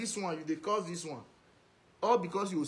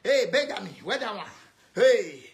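mock loud calling out; mimicked calling out; 5 dB louder than usual. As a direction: imitate someone hollering at others. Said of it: "when they talk like this".

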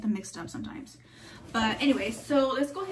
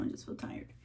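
breathy, worn-out whisper; tired whisper; 11 dB quieter than usual. Real, not acted. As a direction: mumble it, half asleep. Sleepy aside.

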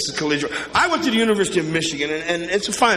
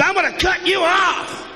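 wife's threat; 9 semitones higher than usual. Retold or imitated. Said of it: "She says".

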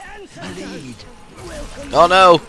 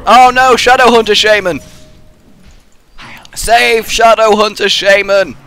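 panicked shout; 5 semitones higher, 4 dB louder than usual. Real, not acted. Panicked call for teammate.

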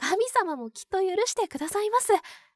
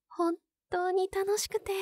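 ordinary talk, nothing marked

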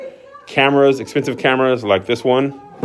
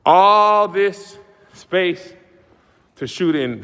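high, very drawn-out awe; theatrical presenter flourish; grand showman hype; 7 semitones higher, much slower than usual. Acted. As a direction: stretch it wide to sell the size. Shows off the huge space.

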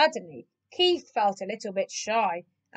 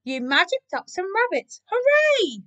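high, delighted congratulation; cheering; 8 semitones higher, 3 dB louder than usual. A narrator's reading. Animals thrilled by the rabbits, cheering. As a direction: build to a high, loud hooray. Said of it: "the other animals cheered".

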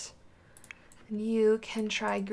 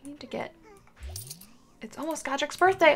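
ordinary talk, nothing marked

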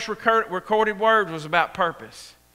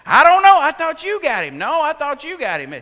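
higher, louder, blaming each other; bickering parents; 5 semitones higher, 6 dB louder than usual. Retold or imitated. Parents arguing over who had the boy, raised and bickering. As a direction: toss the blame back and forth.

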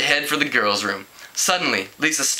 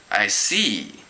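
slow; 6 semitones lower than usual. A narrator's reading. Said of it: "he said slowly".

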